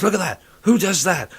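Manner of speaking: high, loud indignant rant; imitating an outraged yokel; mock outburst. Retelling a TV character ranting at a rude passer-by, high indignant outburst.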